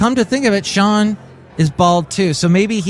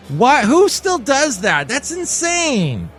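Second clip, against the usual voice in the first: high, drawn-out disbelief; incredulous; 10 semitones higher, slower than usual. Acted. Host reacting to band's lip-syncing, incredulous. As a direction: exclaim slowly in rising amazement.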